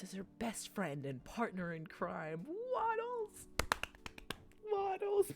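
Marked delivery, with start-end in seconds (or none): 2.5-3.5 high gleeful cry of the name; excited shout. Real, not acted. Excited greeting of the pig. 4.7-5.3 high, very loud squeal of joy; louder excited shout. Real, not acted. Repeats the name even louder.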